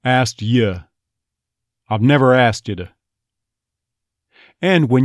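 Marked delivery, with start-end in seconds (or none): 0.0-2.9 Texas "Asked you" with "you" thrown away to "ya"; Texas accent. Shown as a demonstration. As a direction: toss off "you" as "ya", Texas style. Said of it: "usually going to be thrown away".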